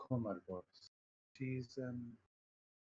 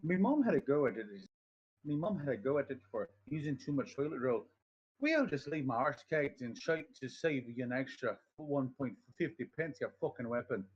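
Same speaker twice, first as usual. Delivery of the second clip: put-on Irish accent; 4 semitones higher, 3 dB louder than usual. Streamer warming up with an Irish meme, loud Irish accent. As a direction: start it loud and Irish, then grumble the insult. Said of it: "that's how I start to warm up my voice".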